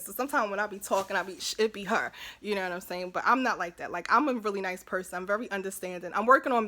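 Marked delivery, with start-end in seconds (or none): none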